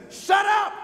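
sharp loud "Shut up" up high; shouted command. Retold or imitated. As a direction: bark it out like a rebuke. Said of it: "and simply says".

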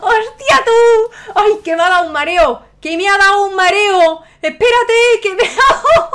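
high, loud, reeling with excitement; overwhelmed shouting. Real, not acted. Streamer made dizzy by his lucky pull, overwhelmed shouting. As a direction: shout it out, overcome by the shock.

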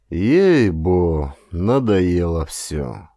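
slow, tired sigh of a grumble; weary grumble. Acted. Cossack muttering to himself, fed up with his orders, slow and weary. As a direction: let it sag, fed up with everything.

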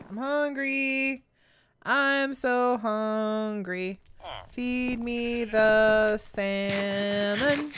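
slow, drawn-out hungry animal voice; lightly voiced moaning creature. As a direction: beg for salmon like a starving pet.

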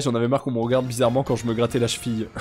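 ordinary talk

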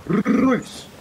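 high, loud imitating a Scottish accent; cartoonish Scottish accent, one word; mock Scottish accent. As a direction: do a quick Scottish accent sound. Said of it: "you know the Scottish accent ... they go like".

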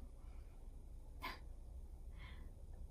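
quiet breathy chuckle; soft laugh. Real, not acted. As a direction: laugh under your breath. Reader laughs at own aside.

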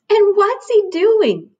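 little bear voice. A narrator's reading. Cub spotting the bathing hare, high and curious.

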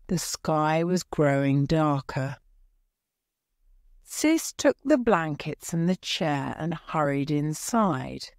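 ordinary delivery, nothing marked

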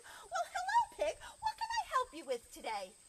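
high, loud chirpy welcome; friendly librarian. Acted. Librarian offers Pig her help, friendly and high. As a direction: greet the customer in a high, eager voice. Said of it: "children's librarian looked at Pig".

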